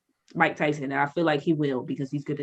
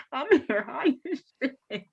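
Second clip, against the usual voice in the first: higher, laughing through the words; laughing at your own high self; 7 semitones higher, 3 dB quieter than usual. Real, not acted. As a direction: crack up while you tell it. Laughing while telling trip story.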